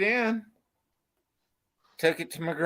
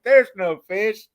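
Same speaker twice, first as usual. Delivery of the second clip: scoffing; 8 semitones higher, 7 dB louder than usual. Retold or imitated. Doubting bystanders quoted.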